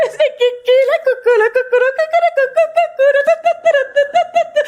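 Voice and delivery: high, sing-song mock Portuguese chatter; mock Brazilian. Teacher mocks Portuguese sounds in high sing-song gibberish. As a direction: bounce it like silly Brazilian gibberish.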